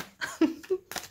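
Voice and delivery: airy unvoiced chuckle, high; soft breathy laugh. Real, not acted. Reader to viewers, amused at the pile, quiet breathy laugh. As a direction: let out a breathy little laugh.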